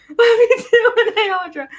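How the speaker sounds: high, laughing greeting; giddy affection. Real, not acted. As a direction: call out to friends through laughter. Laughing greetings to live chat.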